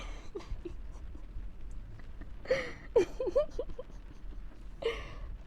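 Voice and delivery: helpless giggling; real breathless giggle; high wheezing laugh. Real, not acted. Laughing at Crocs pin.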